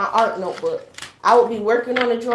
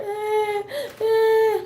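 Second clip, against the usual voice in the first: high-pitched whiny wail; mock crying; 13 semitones higher than usual. Girl left behind by siblings, imitated crying. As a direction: imitate a child's drawn-out crying whine. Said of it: "I'm still in the house crying".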